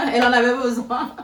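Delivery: teasing through real laughter; giggling while joking about her friend; laughing. Real, not acted. (en riant, taquine)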